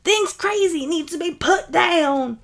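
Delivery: high, loud, heated challenge; shouted confrontation. Acted. Mob member confronting the protector, high urgent shout. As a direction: yell it at someone shielding a threat.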